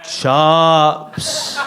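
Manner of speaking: "Chops", the vowel opened and drawn out; different intonation. Shown as a demonstration. Poet retries the pub order, different intonation on "Chops". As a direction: stretch "Chops" as another attempt at saying chips. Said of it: "with a different intonation on each occasion".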